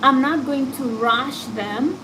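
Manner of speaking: ordinary talk, nothing marked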